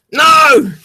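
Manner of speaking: sharp high yell of dismay; anguished shout. Real, not acted. Gamer yelling as the character falls again. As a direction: cry out as it goes wrong.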